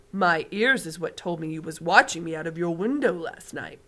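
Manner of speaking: big friendly giant showing off his hearing; booming giant. Acted. BFG voice again.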